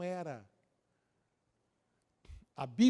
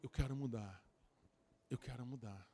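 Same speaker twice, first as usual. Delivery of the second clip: pleading surrender; 7 semitones lower, 7 dB quieter than usual. Acted. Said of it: "tomara que chegue um dia que ela diga".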